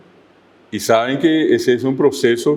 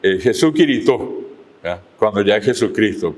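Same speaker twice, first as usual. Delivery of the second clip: ordinary talk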